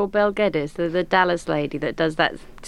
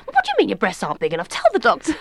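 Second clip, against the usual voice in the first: rushed mother's nagging, rising high at the end; embarrassing mother; 4 semitones higher, faster than usual. Acted. Mother pressing an embarrassed daughter, rapid insistent voice. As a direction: rattle it off, then jump up on the order. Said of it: "My mum was one of those mums".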